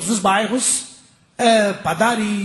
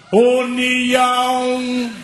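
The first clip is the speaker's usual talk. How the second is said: very long, higher held call; louder driver's shout; 4 semitones higher, much slower than usual. Retold or imitated. Driver repeats the stop louder, extra-long shout. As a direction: bellow the stop, holding the vowels very long. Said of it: "Aí ele grita mais alto".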